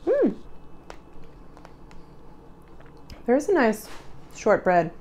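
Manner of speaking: talking between bites; chewing while talking. Real, not acted. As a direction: mouth partly full. Seen 0: Bites cookie at -5s; lips closed, jaw chewing while speaking.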